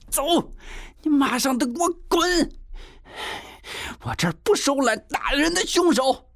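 high-pitched, enraged ordering out; furious eviction. A narrator's reading. Landlady throws family's luggage out, furious eviction. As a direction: throw them out with a high, angry cry. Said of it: "气得无名火一下子就发了出来".

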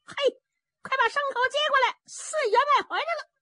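high, fast excited holler; calling out. A narrator's reading. Zhao Bi hollers for someone to take the horses, calling out high. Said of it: "赵壁就喊上了".

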